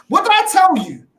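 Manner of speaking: ordinary talk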